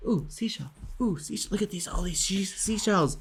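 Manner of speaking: high, soft cooing over little treasures; mock wonder. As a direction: imitate an easily delighted beachgoer. Mimics beachgoers marvelling at seashells.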